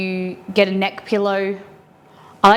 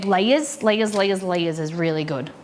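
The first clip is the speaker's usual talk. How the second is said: ordinary talk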